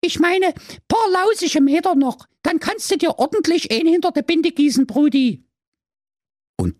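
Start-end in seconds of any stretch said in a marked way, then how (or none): 0.0-5.5 put-on much higher chirpy girl voice; little sister. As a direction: cheer him on like a kid sister. Sister figure.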